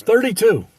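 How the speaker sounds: loud, high mock deli call; calling out number. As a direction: shout the ticket number to the crowd. Deli man calls the number.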